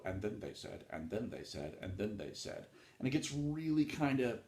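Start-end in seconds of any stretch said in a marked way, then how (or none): 0.0-2.6 tedious repetition. Shown as a demonstration. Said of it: "you have to then go".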